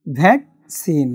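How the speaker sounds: ভ্যাকসিন drawn out slowly with the double c said as k-s; teacher slowly modelling ভ্যাকসিন; slow model word. Shown as a demonstration. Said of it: "সি I N E".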